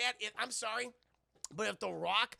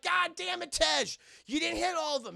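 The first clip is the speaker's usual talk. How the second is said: high, loud frustrated shout; angry yell; 9 semitones higher, 7 dB louder than usual. Retold or imitated. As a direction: chew Tej out mid-chase. Roman yelling at Tej.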